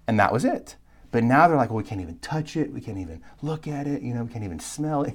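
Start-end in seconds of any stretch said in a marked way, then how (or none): 1.7-5.1 softer, quick, mock-petulant whine; hint of a sulky child piling it on; lightly mocking a petulant complaint; petulant exaggeration. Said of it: "in this sort of petulant way".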